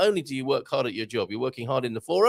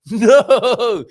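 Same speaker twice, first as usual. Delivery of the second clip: laughing cry; 10 semitones higher, 12 dB louder than usual. Real, not acted. Mock horror with laugh.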